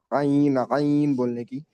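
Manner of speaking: ordinary talk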